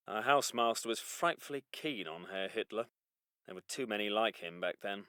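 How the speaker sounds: very low, hushed, sombre officer; quiet reflection. Acted. Officer musing to chaps on the Jerrys, hushed and grave. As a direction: confide it softly, almost to yourself.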